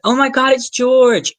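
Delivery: high, loud, drawn-out squeal of recognition; imitating an excited star spotting a fan; excited greeting. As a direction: exclaim it in thrilled surprise, stretched out. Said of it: "and she said".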